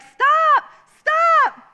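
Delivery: put-on high, loud child's yell; yelling stop. Nine-year-old boy yelling at bulldozer driver, high shout. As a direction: shout it high like a scrawny kid.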